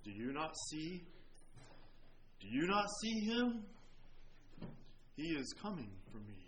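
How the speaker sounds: soft, hushed, fearful question; hushed dread. Retold or imitated. Preacher as the dying man, whispering in dread of the devil. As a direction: hush it as if seeing the devil. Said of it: "he said".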